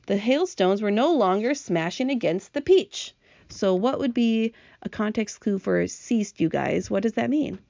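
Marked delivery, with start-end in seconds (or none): none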